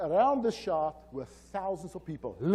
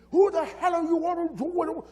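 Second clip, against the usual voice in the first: high, loud Irish boss outburst; retold yelling; 12 semitones higher, 7 dB louder than usual. Angry boss to a stranger in his office, yelling. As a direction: yell it as the furious boss, voice way up. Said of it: "he yells at me".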